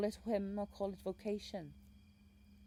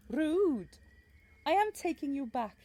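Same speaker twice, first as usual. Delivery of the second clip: offended; 9 semitones higher, 11 dB louder than usual. Acted. Guide reacts to being asked.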